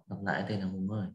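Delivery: ordinary delivery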